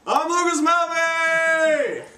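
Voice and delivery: very high, long-drawn yell; loud hammed-up self-introduction; showman's shout. Shown as a demonstration. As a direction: announce yourself triumphantly, stretching the last syllable. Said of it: "and then say your name".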